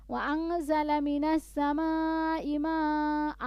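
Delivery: melodic line ending at the pause; recited up to the stop. Shown as a demonstration. Teacher demonstrates stopping mid-verse. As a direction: stop cleanly after ماء. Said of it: "থামলেন, আবার বলবেন".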